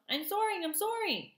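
very high, thrilled squeals; excited gosling. A narrator's reading. Gosling shouts to his mother while soaring, high and thrilled. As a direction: cry out in pure delight.

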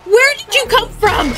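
high-pitched, alarmed demand; startled cry. Real, not acted. Player to a robot that popped up from nowhere, startled. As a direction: burst out high in shock.

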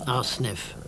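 ordinary delivery

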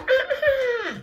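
very high imitated shriek; squealed mock outcry; friends' shocked wail. Friends seeing the date laid out, high mock wail. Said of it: "Her friends was like".

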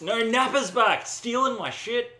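high, loud protest; indignant gamer yell; exasperated shout. Real, not acted. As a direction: raise the alarm about a thief. Thief returns in game.

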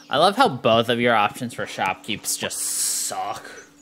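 ordinary talk

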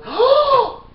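sudden gasp, then a loud high curse; shocked gasp and curse. Real, not acted. Gasping and swearing after pouring in too much pepper. Sees all the pepper spilled.

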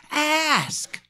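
insistent high-pitched ASK. Shown as a demonstration. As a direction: ASK high and drawn out, kept soft. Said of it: "he could raise his voice a little".

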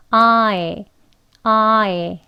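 아에 said wide then narrowing; diphthong drill. Shown as a demonstration. Teacher drills 아에 twice, clear glide. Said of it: "아에라고 발음하시면 되겠습니다".

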